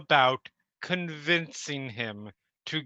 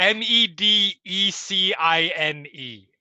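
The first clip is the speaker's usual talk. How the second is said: higher, louder, letter by letter; spelling out; 4 semitones higher, 5 dB louder than usual. Shown as a demonstration. Player proving the sheet's typo, spelling it out loudly. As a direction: spell each letter out emphatically.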